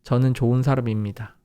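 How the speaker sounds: rapid, run together in one breath; one quick burst; all at once. Shown as a demonstration. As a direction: say it at once, fast. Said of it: "At once".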